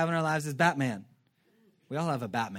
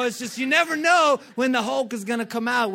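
normal talking voice

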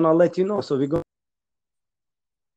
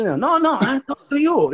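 angry grandma; 9 semitones higher than usual. Retold or imitated. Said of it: "And she".

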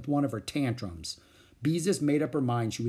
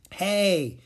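put-on higher, louder boy's call; 7 semitones higher, 4 dB louder than usual. A narrator's reading. Boy calling loudly to complain. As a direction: call out to tattle. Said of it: "in a loud voice".

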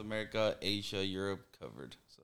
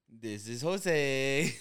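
put-on slow, loud, higher Spanish-accent intro; Spanish-accented introduction; 5 semitones higher, 6 dB louder, much slower than usual. Mimicking how people in Peru introduce themselves, slow Spanish accent. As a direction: draw out Jose the Spanish way. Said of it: "people in Peru are like".

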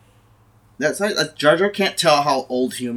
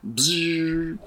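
buzz sound; 2 semitones higher, 5 dB quieter than usual. Pretend. Sound effect in acted scene.

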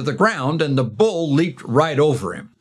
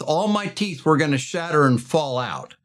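ordinary delivery

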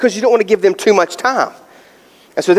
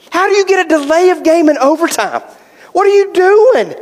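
exasperated outrage; 10 semitones higher than usual. Retold or imitated. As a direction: demand an answer from the team. Fan furious at penalty.

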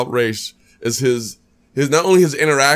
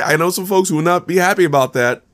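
normal talking voice

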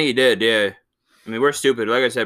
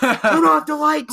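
high, loud falsetto, drawn out; hammed-up alarm, stretching the words; mock panicked shout; 5 dB louder, slower than usual. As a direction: squeal a slow, comic panic order. Acting out panicked household seeing them coming.

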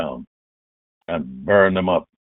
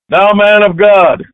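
high, loud, drawn-out captain's call; shouted summons; 11 semitones higher, 8 dB louder, slower than usual. Acted. Captain addressing the man of God, shouted summons. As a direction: call out slowly and loudly up the hill.

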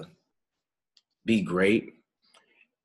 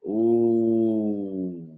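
ordinary talk

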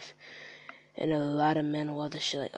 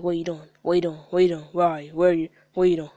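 louder, insistent repeated questions; pestering messagers; 8 dB louder, faster than usual. Retold or imitated. Rapper imitating nosy people in his DMs, pestering. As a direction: mimic people badgering him in quick bursts. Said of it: "They always D Ming me like".

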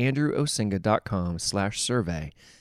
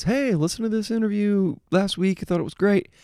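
a chirpy fan's voice, pitched much higher; imitating a listener; 11 semitones higher, 3 dB louder than usual. Host mimics a fan praising last week's interview, much higher voice. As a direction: play an eager listener who stopped him to praise the show. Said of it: "somebody walks up to me and says".

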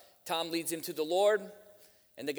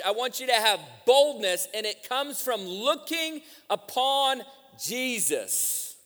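forceful, raised sermon voice; preaching; 7 semitones higher, 9 dB louder than usual. Real, not acted. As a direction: drive each phrase home to the congregation.

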